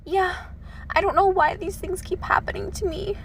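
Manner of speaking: high, soft, put-upon whine; mock helpless whine. Mocking a self-pitying acquaintance, high helpless whine. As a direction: mimic their helpless innocence. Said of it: "And then they're just like".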